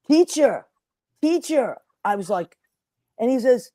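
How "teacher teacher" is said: calling teacher. Retold or imitated. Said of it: "comes up and he says".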